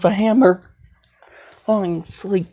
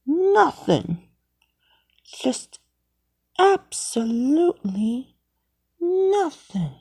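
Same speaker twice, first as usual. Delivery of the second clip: innocent denial; 6 semitones higher, 5 dB quieter, slower than usual. Acted. Caught, denies doing anything.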